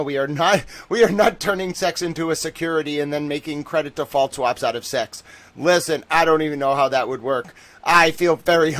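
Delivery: loud, high, laughing protest; excited laughing. Real, not acted. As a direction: burst out laughing and protest loudly. Reacting to a viewer's joke.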